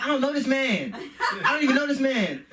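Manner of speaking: high-pitched mock disowning of a stranger. Kid denying knowing the man, high mock protest. Child disowns parent onstage.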